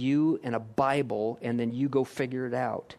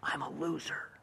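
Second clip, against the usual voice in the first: much softer, a hushed whisper in your own voice; sneaky whisper; 11 dB quieter than usual. Retold or imitated. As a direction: breathe "I'm a loser" into the listener's ear like a hidden tempter. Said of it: "whispers in a voice that sounds exactly like your voice".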